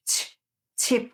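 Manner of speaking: ordinary delivery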